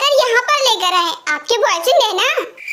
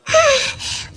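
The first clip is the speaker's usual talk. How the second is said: sigh of relief; 4 semitones higher than usual. Acted. Fire finally put out.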